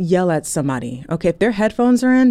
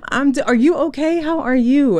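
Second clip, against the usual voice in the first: higher, louder overeager voice; eager mock greeting; 7 semitones higher, 3 dB louder than usual. Stranger fishing for the question back, overeager.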